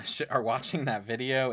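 ordinary talk, nothing marked

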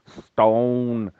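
put-on kids saying "Stone" with a broad Mancunian vowel; drawn-out Mancunian "Stone, stone". Kids in China copying a Mancunian teacher, broad Mancunian "Stone". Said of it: "they've got Mancunian accent when it comes to rocks".

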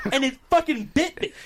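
outraged high-pitched retelling; excited outrage. Retold or imitated. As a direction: pitch shoots way up in shock. Retelling shocking bite.

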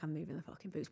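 low conspiratorial murmur, retelling a prank; sneaky aside. Retold or imitated. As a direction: imitate a hushed, naughty confession. Retold sneaky prank aside to mum.

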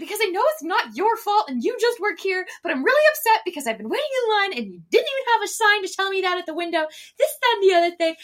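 high, loud polite rant; yelling nicely. Retold or imitated. Customer venting at the barista, high, loud and polite. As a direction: scold sweetly at a squeaky pitch. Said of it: "is, like, yelling at me, but, like, nicely".

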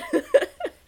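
high, loud laugh. Real, not acted. Laughing at favorite pastime.

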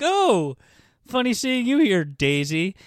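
high, loud mock surprise, settling at the end; hammed-up casual "oh!"; feigned surprise Gatsby. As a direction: imitate a badly faked chance meeting. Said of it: "all nervous".

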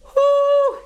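loud high whoop; excited whoop. Real, not acted. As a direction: whoop out through the burn. Cheering through the spice.

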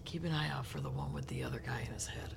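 hushed fast whisper aside; fast whispered aside to She of Long Hair. Acted. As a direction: secretly warn her, unvoiced. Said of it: "I want to whisper an aside".

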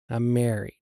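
low, soft retold reassurance; understated, said softly and low; quiet reassurance. Husband reassuring her after the accident, quiet and low.

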